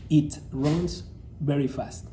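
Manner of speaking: slow model sentence. Shown as a demonstration. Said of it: "Vamos a imaginar que estamos hablando de un animal".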